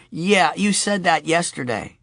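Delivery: put-on a small girl, a bit impatient; little girl. A narrator's reading. Sophie reminds her father, in a high child voice.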